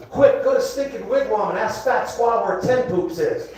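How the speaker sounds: ordinary talk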